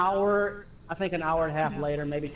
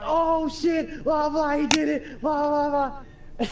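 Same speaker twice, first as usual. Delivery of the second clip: excited hollering; 9 semitones higher, 4 dB louder, slower than usual. Retold or imitated. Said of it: "All screaming".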